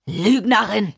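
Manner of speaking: explosive, forceful accusation; enraged accusation. Acted. John rejecting Lina's denial of the story, enraged. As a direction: hurl the word out in rage.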